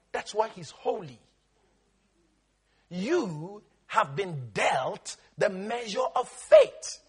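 ordinary talk, nothing marked

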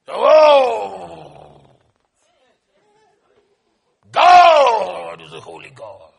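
loud, slow, drawn-out cry; preacher's fervent cry. Real, not acted. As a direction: cry it out from the chest. Preacher cries out holiness.

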